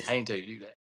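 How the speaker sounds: a touch of amused divine sarcasm; wry God voice. Retold or imitated. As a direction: hand God a dry, knowing retort.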